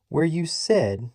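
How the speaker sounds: said stressed, slow and deliberate; emphasis on said. A narrator's reading. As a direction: lean hard on said. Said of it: "he emphasized the word".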